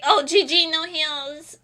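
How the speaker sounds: a hammed-up blaming gamer; mock complaining teammate. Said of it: "They're like".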